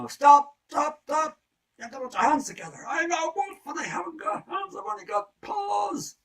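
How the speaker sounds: high, loud, exasperated squeal; wolf shrieking high about his paws; frustrated shout. Acted. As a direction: yell it in total frustration.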